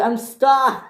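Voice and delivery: high-pitched cry of alarm; acted high plea; calling for help. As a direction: call out high that you're trapped. Stuck engine calls for help.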